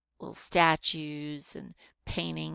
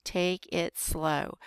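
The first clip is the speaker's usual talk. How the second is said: very slow, each word drawn out; slow-paced teacher's demonstration; deliberately slow; much slower than usual. Shown as a demonstration. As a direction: stretch out every word to show slowness. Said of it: "I was trying to show you".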